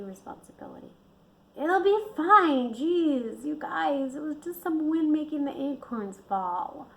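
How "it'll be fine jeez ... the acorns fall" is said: raspy, dismissive, a bit high. Acted. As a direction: rasp it out, brushing it off. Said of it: "her voice raspy".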